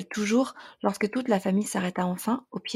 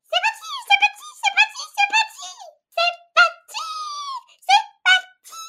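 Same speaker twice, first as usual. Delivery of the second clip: put-on high squeaky baby voice shrieking in panic; a tiny hedgehog running in circles, squealing; panicked squeal. A narrator's reading. Baby hedgehog screams in fright, high squeal. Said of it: "en hurlant".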